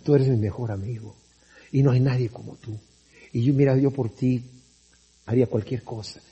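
soft, low, cloying flattery; fake sweet-talker voice. Preacher plays a two-faced friend professing loyalty, in a soft, low, syrupy voice. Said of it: "de repente cambia la voz y te dice".

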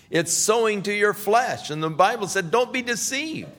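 high, rising to a loud warning; building preaching. Acted. Pastor warning the congregation about the flesh, rising and loud. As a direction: hammer the warning home. Preaching climax against television.

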